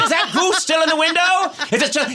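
high, urgent mock-Dickens voice; hammed-up Victorian excitement; excited Scrooge send-up. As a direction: call out eagerly as in a Christmas tale. Plays excited Scrooge-like buyer.

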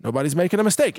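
normal talking voice

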